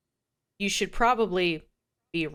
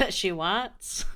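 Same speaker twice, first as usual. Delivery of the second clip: WANTS as an amused rising question; mimicked mispronunciation; 3 dB quieter than usual. Co-host mimics the reader's odd delivery, teasing question on WANTS. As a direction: echo "wants" back teasingly, lifting the end. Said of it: "Did I say it like that?".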